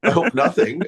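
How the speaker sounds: laughing it off, pitch high and slow at first. Real, not acted. Asked what would surprise people about him, answers laughing. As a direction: chuckle through a bashful dodge.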